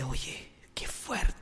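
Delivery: slow, breathy whisper; hushed shock. Retold or imitated. Friend to the priest after the exorcism, stunned whisper.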